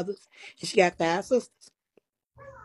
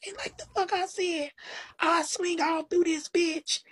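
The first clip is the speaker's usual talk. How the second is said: much higher, slow, put-on mama voice; hammed-up mama threatening, much higher and drawn out; mama going off; 11 semitones higher, 4 dB quieter than usual. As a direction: imitate mama bucking up for a fight. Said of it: "now she bucking".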